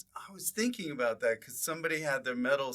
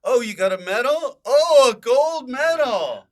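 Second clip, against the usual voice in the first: impressed admirer; 10 semitones higher, 12 dB louder than usual. Acted. People mistaking a bronze for gold, gushing loudly. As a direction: gush loudly over the medal. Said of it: "some people will think".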